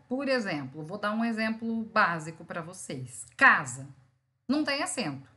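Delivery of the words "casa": Casa with a loud, strong CA; stress on first syllable. Shown as a demonstration. Teacher showing students the tonic syllable, Casa stressed on CA. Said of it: "não pronuncio mais forte o K".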